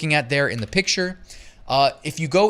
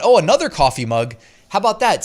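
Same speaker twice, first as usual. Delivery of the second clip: louder, higher, playfully surprised; mock surprise; 5 semitones higher, 7 dB louder than usual. Host moving on to another coffee mug, playfully surprised. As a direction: act pleasantly surprised, loud and lively.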